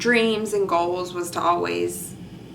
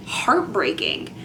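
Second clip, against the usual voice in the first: ordinary talk, nothing marked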